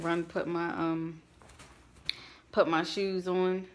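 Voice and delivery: usual speaking voice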